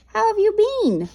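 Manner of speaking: "How have you been?" in a high, fast, bright voice; high quick greeting. Shown as a demonstration. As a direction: squeak it out like a jokey hello. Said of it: "Get it?".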